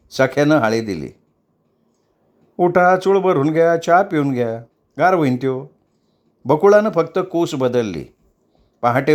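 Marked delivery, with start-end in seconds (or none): 2.6-5.7 high raised calling voice of a maid; calling to wake her. Acted. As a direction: call her up from sleep, lively. Sakhya urging her up.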